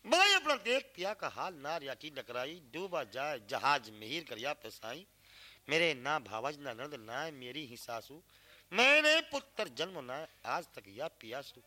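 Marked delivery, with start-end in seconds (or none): none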